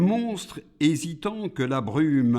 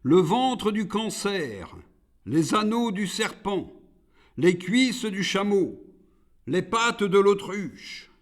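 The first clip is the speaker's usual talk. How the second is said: high-pitched recited shopping list; insistent Iblis, pitched up; demanding demon; 10 semitones higher, 6 dB louder than usual. A narrator's reading. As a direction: reel off each demand high, item by item. Iblis continues his list.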